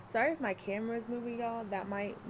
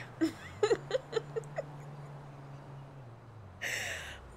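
low, breathy laugh close to crying; low wheezing laugh-cry; 8 semitones lower, 5 dB quieter than usual. Real, not acted. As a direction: laugh until it nearly turns to tears. Laughing after choking episode.

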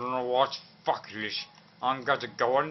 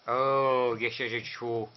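ordinary talk

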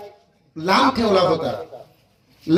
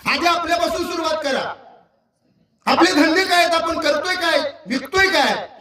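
high-pitched, insistent rebuke from the pulpit; exasperated preacher telling people off; heated scolding; 6 semitones higher than usual. Real, not acted. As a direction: scold them in rapid, high bursts. Preacher scolds fellow speakers over time.

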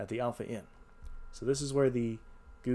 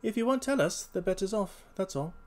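high voice easing toward natural pitch; breezily bait the stallholder, lightly acted; casual detective; 5 semitones higher than usual. Holmes proposes a bet.

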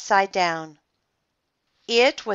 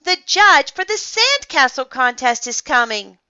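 higher, louder announcement to the group; raised, urgent voice; shouting; 6 semitones higher, 6 dB louder than usual. A narrator's reading. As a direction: call out the news excitedly. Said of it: "somebody shouted".

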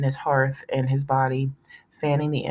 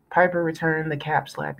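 usual speaking voice

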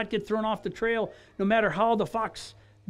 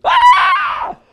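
startled shriek; 12 dB louder than usual. Real, not acted. Shocked the coach is calling.

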